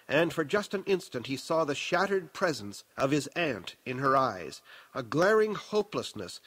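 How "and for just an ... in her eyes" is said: usual speaking voice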